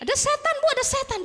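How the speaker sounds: high-pitched panicked repeated cry; mock terrified scream. Nanny calling her employer after horror films, mock panic. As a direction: act out a nanny shrieking about a ghost. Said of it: "Saya kan panik".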